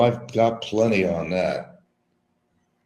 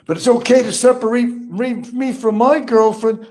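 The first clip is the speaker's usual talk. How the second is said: high, loud mocking quote, slowing down; Barry impression; 13 semitones higher, 4 dB louder than usual. Mocking Barry's famous statement back at him, high mock voice. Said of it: "But Barry's famous statement".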